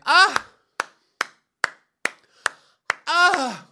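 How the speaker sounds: high loud mock cheering gasp; crowd cheer imitation. Imitating the room cheering for the couple, high loud gasp. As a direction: mimic a delighted crowd gasping. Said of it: "the whole room is just like".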